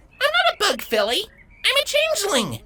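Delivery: very high filly voice calling down in protest; protesting shout. A narrator's reading. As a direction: shout down indignantly from above. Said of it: "Avia shouted down in protest".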